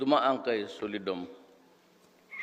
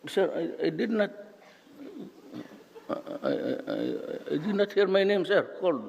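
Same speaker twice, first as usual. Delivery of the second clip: higher, halting mock-nervous reply; stammering student; 7 semitones higher, faster than usual. Student explaining himself to professor, nervous stammer. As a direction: stammer out an excuse to authority.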